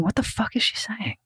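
hushed, breathy whisper. Retold or imitated. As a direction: whisper it like a confused viewer. Imagined viewer whisper.

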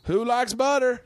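very high, drawn-out folksy hollering; impression of wife's family. Husband imitating wife's family raving about butter, high folksy impression. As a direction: whoop it up in a squeaky-high country voice. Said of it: "This is my impression of your family".

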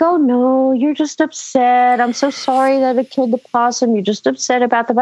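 lightly voiced placating mother; a hint of patronizing soothing, imitated; dismissive mom. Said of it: "like everything is fine".

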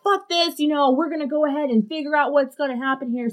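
mock defiance, pitched well up; fed-up rallying. Friend retelling how the tenant decided to act, fed-up and high. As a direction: rattle it off high, like someone taking charge.

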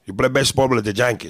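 mock Cuban accent with "Yankees" as "Jankees". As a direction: put on a thick Cuban ballplayer accent. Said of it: "that's what they wanted".

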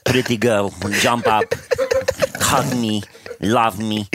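broken English in a thick mock Russian accent; short halting phrases, hammed-up Russian accent; Vladimir Tarasenko. Podcaster joining the hockey star bit, comic Russian accent. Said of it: "That's Vladimir Tarasenko".

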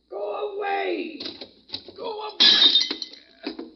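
high, wailing cry to go away; crying shout. Acted. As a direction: howl it out, half sobbing. Old man shouting visitor away.

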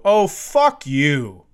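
slow, loud exasperated curse; loud, slow fed-up curse at the phone; snapped curse. Retold or imitated. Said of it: "That's what I said".

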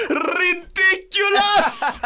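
put-on high, slow Spanish-accented shout; hammed-up Latin exclamation; mock Spanish shout. Rolled-R Spanish-flavoured shout.

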